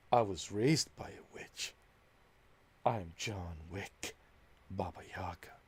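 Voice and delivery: John Wick voice. Pretend. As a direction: growl it softly like a grim assassin.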